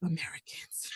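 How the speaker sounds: breathy whispered aside. Real, not acted. Friend whispers a wry aside about Americans. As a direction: drop to a whisper as if sharing a secret. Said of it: "whispered aside about Americans".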